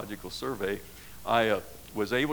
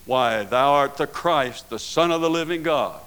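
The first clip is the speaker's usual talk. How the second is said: Peter's confession; 5 semitones higher, 9 dB louder than usual. Retold or imitated. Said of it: "And Jesus says".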